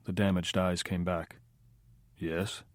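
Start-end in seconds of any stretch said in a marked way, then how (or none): 2.2-2.6 quiet. A narrator's reading. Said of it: "the tone quiet".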